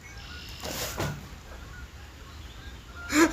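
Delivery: breathless high giggle; cracking up, out of breath; high wheezy laugh. Real, not acted. Cracks up at own meth joke.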